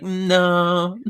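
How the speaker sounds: long mock-protesting "no"; mock disbelief. Reader reacts to her driving a car, mock disbelief.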